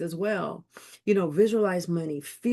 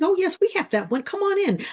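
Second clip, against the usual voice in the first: high, bright-eyed shop clerk welcoming her in; eager saleswoman; 12 semitones higher than usual. Retold or imitated. Clerk greeting a customer at Tiffany's, pitched high and eager. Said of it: "She says".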